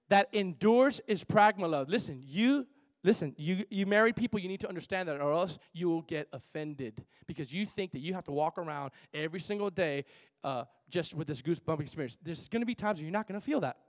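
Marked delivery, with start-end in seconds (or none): none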